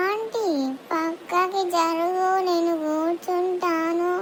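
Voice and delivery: drawn-out, very slow words; very slow talker. Acted. Slow-talking girl asks aunty to shift over, very slowly. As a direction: stretch every syllable, barely pushing. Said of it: "గట్టిగా మాట్లాడ నీకు రాదా?".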